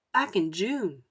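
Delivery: plain reading voice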